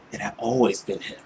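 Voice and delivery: usual speaking voice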